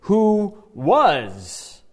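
"Who was" with weight on "was"; stress on was. Shown as a demonstration. Teacher stressing "was" for the class, emphatic. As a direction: lean on "was" to mark past tense. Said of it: "notice the, the tense of the word".

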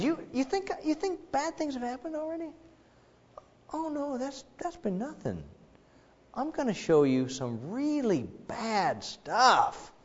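a knowing, high half-whisper; God voice, mocking. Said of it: "And God says".

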